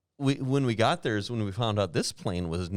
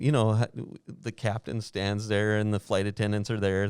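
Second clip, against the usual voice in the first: ordinary talk, nothing marked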